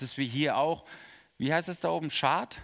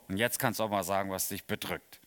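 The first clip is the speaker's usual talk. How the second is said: gentle invitation; 7 semitones lower than usual. Retold or imitated. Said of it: "dann sagt er so".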